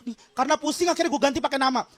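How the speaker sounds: ordinary delivery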